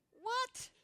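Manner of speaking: high, loud, shocked "What?"; a startled cry of disbelief. Retold or imitated. Prostitute stunned at the invitation, shocked cry.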